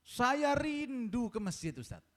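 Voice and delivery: high, slow, drawn-out yearning; imitated fervent longing; heartfelt longing. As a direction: stretch it out with aching wish. Sick man voices longing for mosque.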